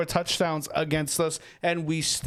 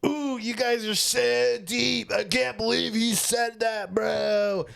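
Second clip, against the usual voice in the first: mock fanboy; 5 semitones higher, slower than usual. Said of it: "I don't think that Jalen and Nick are some, like".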